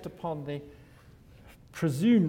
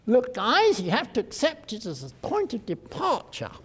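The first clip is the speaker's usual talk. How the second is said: lightly voiced poncy theorist, a touch higher; poncy theory type; 7 semitones higher, 3 dB louder than usual. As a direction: lecture the students like a pompous theorist. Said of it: "slightly poncy, probably theory character".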